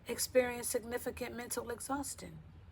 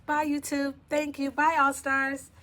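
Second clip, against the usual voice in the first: high, loud goodbye, last words stretched; loud sign-off; 4 semitones higher, 9 dB louder, slower than usual. Real, not acted. Host thanks viewers and leaves, loud drawn-out sign-off. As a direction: belt out the farewell to the fans.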